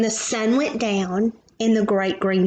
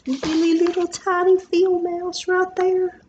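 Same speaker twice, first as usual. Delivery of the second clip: bright higher voice pointing out the tiny mouse; delighted high; 8 semitones higher than usual. Real, not acted. Parent showing child the mouse, delighted and high.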